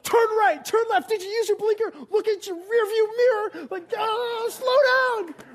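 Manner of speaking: high, loud mock panic of a nervous parent; panicking parent. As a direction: shout frantic orders at the new driver. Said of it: "they're like".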